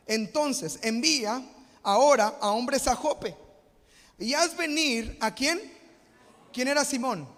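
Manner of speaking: loud and high, driving the point home; full-force preaching. Acted. Pastor preaching on prayer to the congregation, loud and high.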